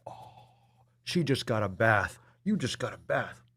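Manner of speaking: soft cooing pet voice. Real, not acted. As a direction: coo gently to the cat.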